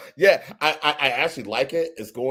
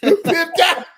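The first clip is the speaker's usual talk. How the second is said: high, loud cry through laughter; laughing catchphrase; 8 dB louder than usual. Retold or imitated. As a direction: crack up as you call it out.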